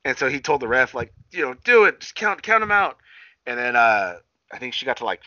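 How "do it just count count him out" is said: imitating Dustin's higher, louder command; mock order to the ref. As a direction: bark it like a cocky wrestler.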